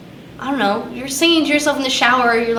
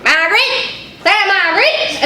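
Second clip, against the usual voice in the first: higher-pitched mock call from the back door; imitating dad calling; 7 semitones higher than usual. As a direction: call someone in for lunch, imitating a parent. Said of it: "he'll open the door and he'll be like".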